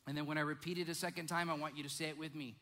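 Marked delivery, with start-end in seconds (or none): none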